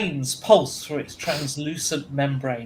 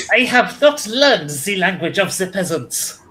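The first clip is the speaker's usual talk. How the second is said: proud officer, fake French accent, louder; 5 semitones higher, 7 dB louder than usual. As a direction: declare it haughtily in a French accent. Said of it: "that was my fake French accent".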